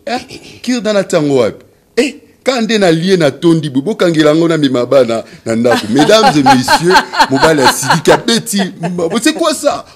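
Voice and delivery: high, loud, laughing shrieks of outrage; loud mock outrage. Comedian's lady loudly demands a toothpick, laughing. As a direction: shriek in hammed-up indignation through laughter.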